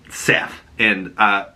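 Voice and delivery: ordinary talk, nothing marked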